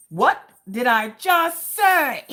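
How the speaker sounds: slow, high, loud mock scolding; acted-out exasperated yell; exasperated shout. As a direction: shout it word by word, fed up. Acted exasperation at husband's call.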